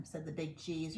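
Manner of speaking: ordinary delivery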